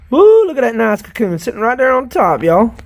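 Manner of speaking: pleased with the find; excited. Real, not acted. Spots a nice cocoon.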